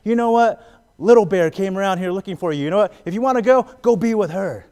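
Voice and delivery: high, loud jealous shouting; jealous wife. Retold or imitated. Wife accusing husband over Little Bear, loud and high. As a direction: yell it at him like a jealous wife. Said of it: "his wife's like".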